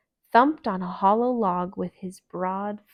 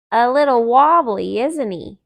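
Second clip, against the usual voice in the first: cheeky remark; 3 semitones higher, 11 dB louder than usual. Acted. Thumper teases the fawn.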